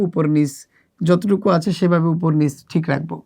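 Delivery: ordinary talk, nothing marked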